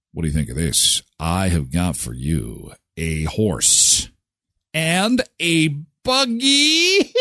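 a thief proudly presenting his loot, dragged out; slow sly seller pitch. Pretend. Thief offering horse and buggy.

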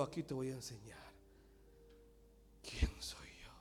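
near-whisper sermon, breathy and soft; hushed whisper preaching. Acted. Preacher showing God's whisper, hushed throughout. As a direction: preach as if telling a secret.

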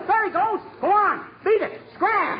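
high-pitched hollering; frantic shooing. Acted. Husband driving the owl outside, frantic shooing. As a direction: bark short orders, voice way up high.

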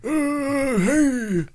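high, put-on old man voice. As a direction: play a confused old caller. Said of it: "Why don't you be the old man?".

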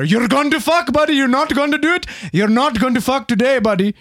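hammed-up Indian accent, threatening; mock Indian accent. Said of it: "he's got this really thick Indian accent".

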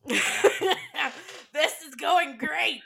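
ironic line, laughing through it; laughing sarcasm. Real, not acted. Host laughing at her own awkward podcast chatter. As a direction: laugh as you say it's going great.

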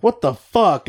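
alarmed shout; shocked outburst. Retold or imitated. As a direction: react to a bleeding chin. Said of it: "they're like".

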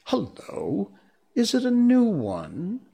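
put-on high-pitched boyish question; boy's voice lifting sharply in pitch; curious boy. A narrator's reading. Narrator voices curious boy Peter.

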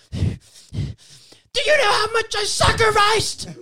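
high, slow, furious scream; screamed line. Acted. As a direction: scream it out like a betrayed villain. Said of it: "Just fucking scream it".

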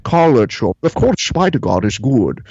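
Connery-style brogue. Acted. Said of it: "the Sean Connery impression that I do".

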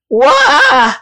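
high startled yell; startled shout. A narrator's reading. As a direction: let out a high "Wah!" in alarm. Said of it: "the fisherman shouted".

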